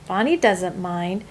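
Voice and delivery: ordinary delivery, nothing marked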